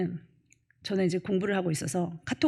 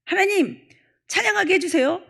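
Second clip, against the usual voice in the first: mock prayer; 12 semitones higher, 7 dB louder than usual. Mocking a believer's prayer.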